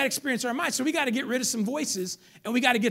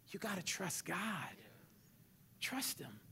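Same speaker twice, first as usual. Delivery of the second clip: whispered plea; 15 dB quieter, slower than usual. Real, not acted. Preacher to congregation, whispering trust. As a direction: breathe it gently. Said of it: "soft appeal".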